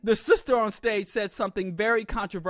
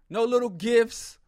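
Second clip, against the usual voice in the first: ordinary delivery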